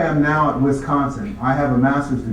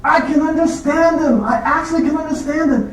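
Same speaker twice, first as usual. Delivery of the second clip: much higher, amazed secretary voice; imitating the secretaries; 3 dB louder than usual. Secretaries in the outer office marvelling, high amazed voice. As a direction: gush it like a stunned assistant.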